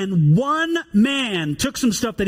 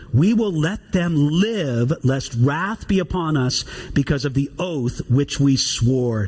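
slow, weighty reading of the oath; scripture reading; 3 semitones lower, slower than usual. Acted. Pastor reading scripture to the congregation, slow and solemn. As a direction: read it slowly, with gravity. Reads scripture passage aloud.

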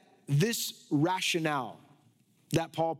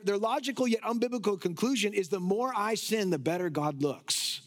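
usual speaking voice

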